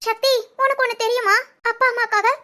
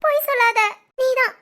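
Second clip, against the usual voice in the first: ordinary talk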